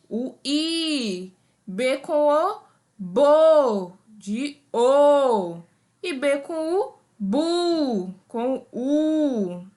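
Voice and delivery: a teacher drilling ba, be, bi, bo, bu aloud for the class; syllables sounded out. Shown as a demonstration. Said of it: "é o som que sai da nossa boca". (sílabas ditas em voz alta, ritmadas como numa aula)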